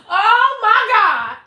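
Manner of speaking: high, loud, slow exasperated cry; loud high disbelief; exasperated outburst. Retold or imitated. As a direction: blow up in disbelief. Reaction before yelling.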